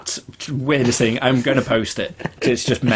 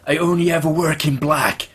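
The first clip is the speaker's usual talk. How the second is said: trailer quote in a put-on, higher voice; mock-serious quoted film line; film character's line; 6 semitones higher than usual. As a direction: act out the demanding movie hero, pitched up. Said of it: "when he's in his press".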